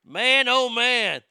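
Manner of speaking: loud, slow, drawn-out disbelief; exasperated groan. Real, not acted. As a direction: shake your head at it out loud. Exasperated at FBI mistake.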